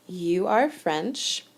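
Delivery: ordinary talk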